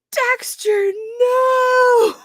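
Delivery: high, drawn-out yell at a dog; owner yelling. Retold or imitated. Poor owner calling Dexter off, high-pitched yell. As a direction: cry out like a horrified dog owner. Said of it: "her poor owner is yelling".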